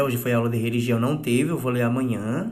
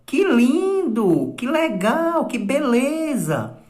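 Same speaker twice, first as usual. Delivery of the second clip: much higher and slow, delighted; admiring exclamation; 10 semitones higher, much slower than usual. Shown as a demonstration. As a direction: gush over something lovely. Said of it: "Na admiração". (admiração exagerada, aguda e lenta)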